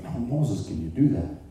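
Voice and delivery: a quiet tender plea to Moses; low and soft; gentle God. Acted.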